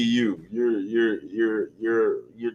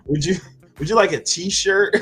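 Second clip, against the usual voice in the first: high laughing greeting; 10 semitones higher, 4 dB louder than usual. Retold or imitated. Laughing as he greets the little fella. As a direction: chuckle through a high friendly hello.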